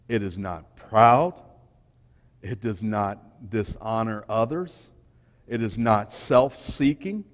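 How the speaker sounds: slow, measured reading of First Corinthians; scripture reading. Acted. Preacher reading the love passage to young people, slow and measured. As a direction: lay each line of the verse down slowly and deliberately.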